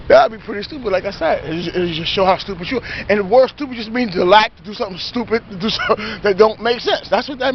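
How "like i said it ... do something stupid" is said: usual speaking voice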